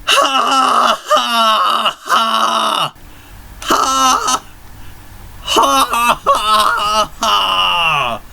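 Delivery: very high echoing evil laugh; distant maniacal laughter. Acted. Titus laughs in the distance, very high maniacal cackle. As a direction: cackle as if from far away. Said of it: "in the distance we could hear".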